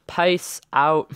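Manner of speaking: odd accent with "Peace" bent toward "pice"; weirdest accent. Real, not acted. Player signing off to viewers, weird accidental accent. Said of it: "the weirdest accent ever".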